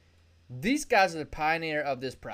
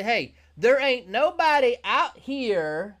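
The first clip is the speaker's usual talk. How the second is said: Zoom's pitch; 6 semitones higher, 5 dB louder than usual. Acted. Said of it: "Zoom jump on this mountain and say".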